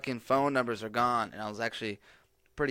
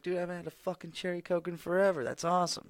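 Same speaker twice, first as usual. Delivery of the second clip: higher-pitched, thrilled nostalgic outburst; excited quote; 7 semitones higher than usual. Retold or imitated. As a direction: gush about finding cherry Coke. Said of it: "And I was like".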